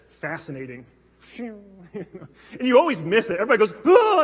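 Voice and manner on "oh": high, loud "Oh" of amazement; sudden awed exclamation; mock gasp of wonder. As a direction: gasp at the sky. Crowd reacts to a meteor.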